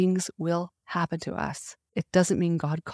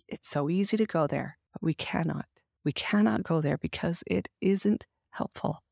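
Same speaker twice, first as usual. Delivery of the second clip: ordinary delivery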